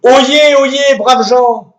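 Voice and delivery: high, loud, slow proclamation; town crier. Acted. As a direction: cry it out to the square. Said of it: "un retour au Moyen-Âge".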